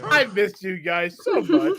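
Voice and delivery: laughing through happy tears; half laughing, half crying. Real, not acted. Emotional reunion, laughing.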